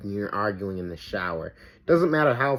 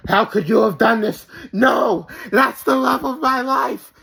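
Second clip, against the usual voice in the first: high, loud, hammed-up crying shout; soap opera wailing; 9 semitones higher, 5 dB louder than usual. Mocking the victim girl's soap opera grief, loud sobbing cry. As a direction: overact a heartbroken soap opera heroine, yelling through sobs. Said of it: "like in a Korean soap opera".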